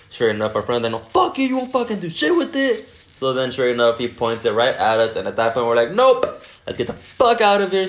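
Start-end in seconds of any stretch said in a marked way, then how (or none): 0.9-2.9 very high imitated heated friend; mock defiance. Friend still defies the gunman, mock heated defiance. 5.9-7.9 high, louder retold urgency; mock alarm. Friends facing a pointed gun, mock alarmed exit.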